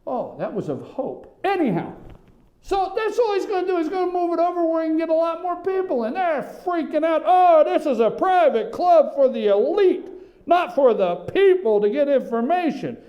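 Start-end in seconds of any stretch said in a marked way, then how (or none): 2.6-7.2 high, fast excited rant; worked up. Real, not acted. Radio host on the press room move, worked up. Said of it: "I'm worked up". 7.2-13.0 louder, hammed-up elitist complaint; mock outrage. Host mimicking press guarding its private club, mock outrage.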